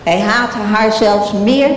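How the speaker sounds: ordinary delivery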